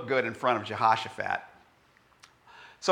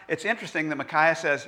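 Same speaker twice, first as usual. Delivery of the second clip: ordinary talk, nothing marked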